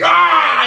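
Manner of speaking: high, loud cartoon roar; imitated rage yell. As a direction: yell like a raging toddler. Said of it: "You're like".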